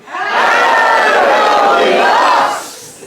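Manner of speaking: loud panto greeting to the crowd; loud call-out. Acted. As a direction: call it out to the back row. That's no good. Come on.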